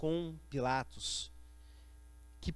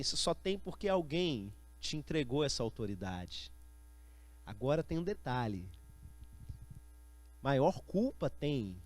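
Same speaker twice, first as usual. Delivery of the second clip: low, soft, unhurried reply; great tranquillity; 5 semitones lower, 6 dB quieter than usual. Acted. Jesus replying to Pilate, very tranquil and soft. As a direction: answer with total calm, voice dropped and quiet. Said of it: "imagino, com muita tranquilidade, fala assim".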